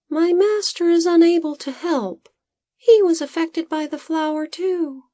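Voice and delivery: high, light little assistant voice; a small worried helper explaining; Bodo's high voice. A narrator's reading. Assistant telling the farmer his master is affected, high voice. Bodo speaks as a character.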